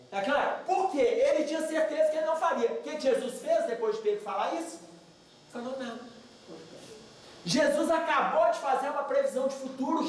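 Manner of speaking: usual speaking voice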